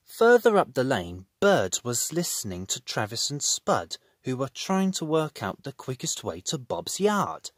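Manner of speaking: ordinary delivery, nothing marked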